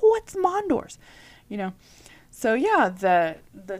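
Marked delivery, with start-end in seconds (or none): 0.0-1.0 high, loud baffled question. Retold or imitated. Asking husband about the diagnosis, baffled and high. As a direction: squeak it out in disbelief. Retelling her confused reaction.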